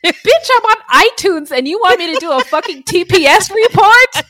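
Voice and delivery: high, loud, shouting triumphant boss-off; hammed-up diva telling off the boss; mock diva rant. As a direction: shout it like a newly famous diva. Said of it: "like, I'm at work, like".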